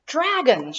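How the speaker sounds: loud, high exclamation of discovery; excited cry. A narrator's reading. Whitney spotting the dragons, crying out excitedly. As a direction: call out the find with delight. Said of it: "she cried".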